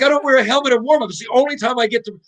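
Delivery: high-pitched retold indignation; indignant protest. Player defending his bare-headed warm-up, indignant protest.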